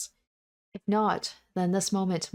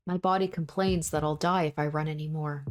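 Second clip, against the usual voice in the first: plain reading voice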